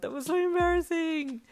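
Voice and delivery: very high, loud mortified wail; embarrassed wail. A narrator's reading. Wailing to Hawks about your bold move, mortified. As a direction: wail it, stretching it out. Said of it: "you wailed".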